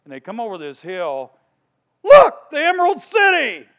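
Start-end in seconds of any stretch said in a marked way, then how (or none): 2.0-3.7 high, loud cry of discovery; excited shout. Retold or imitated. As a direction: call out the sight with joy. Travelers spot the city.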